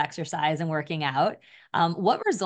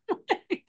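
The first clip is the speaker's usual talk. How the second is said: short high laugh. Real, not acted. Laughs at Ozempic punchline.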